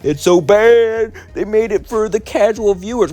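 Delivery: high mocking voice of a complaining fan; mocking whiny critic. Vlogger mocking Fallout show haters, high and whiny. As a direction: sneer it like a petty hater. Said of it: "Everyone's like".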